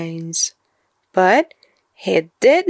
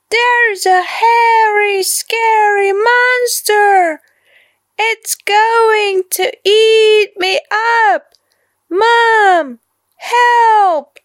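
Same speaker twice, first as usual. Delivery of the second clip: put-on high, loud panicked child's cry; frightened child; 13 semitones higher, 4 dB louder than usual. A narrator's reading. Boy cries out to mom about the monster, panicked. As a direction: shout for help as if terrified.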